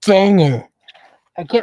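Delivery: muffled through a mask; inside a rubber mask. Real, not acted. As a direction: talk with a mask over your mouth. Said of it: "I can't talk in this mask".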